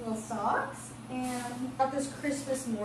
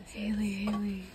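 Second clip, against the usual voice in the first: urgent whispered call; hushed gasp, then calling name; excited whisper; 3 dB quieter than usual. Real, not acted. Excited find in the store.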